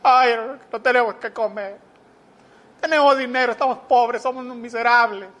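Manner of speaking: hammed-up tearful whine; mock near-crying. Preacher mimics worried brethren lamenting poverty, mock tearful. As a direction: wail it like a film melodrama pauper. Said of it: "llorando casi".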